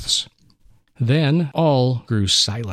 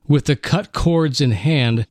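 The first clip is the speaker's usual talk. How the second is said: ordinary delivery, nothing marked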